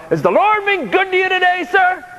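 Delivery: a beaming doorman calling out hello; cheerful security guard. Acted. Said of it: "I hear Joe".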